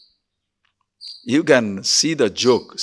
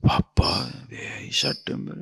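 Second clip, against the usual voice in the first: hushed aside; 13 semitones lower, 10 dB quieter than usual. Acted. Onlookers murmur about the man.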